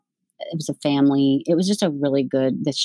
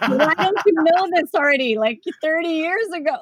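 exasperated; 10 semitones higher than usual. Retold or imitated. Exasperated retort to husband.

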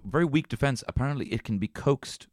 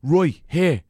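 Dublin scumbag; 7 semitones higher, 6 dB louder than usual. Retold or imitated. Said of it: "he was all like".

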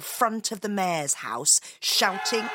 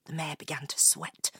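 plain reading voice

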